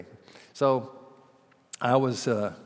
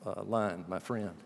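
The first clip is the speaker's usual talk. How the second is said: normal talking voice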